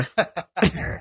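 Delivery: high giggle breaking out; high laugh. Real, not acted. Laughing at own story.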